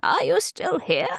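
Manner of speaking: high, put-on questioning voice; imagined letter read-out. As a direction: playfully act out a surprised query. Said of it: "and say".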